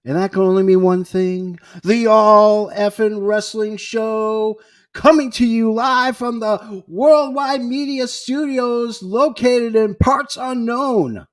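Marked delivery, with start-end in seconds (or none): 1.8-11.3 slow, higher booming show-opener voice; announcer intro. Acted. Host opening the wrestling podcast, announcer boom.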